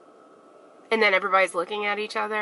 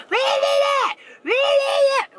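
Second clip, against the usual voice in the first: high shrill shout, much higher and louder; mock screaming mother; 5 dB louder, much slower than usual. Woman yelling at a child over a lost phone, screaming. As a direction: scream it across the store at the kid. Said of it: "And she's like".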